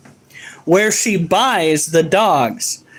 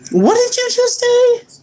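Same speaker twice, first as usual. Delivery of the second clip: high, slow disbelieving squeak; can't believe what he just heard; high-pitched stunned what; incredulous. Real, not acted. Incredulous at wife's odd phrasing.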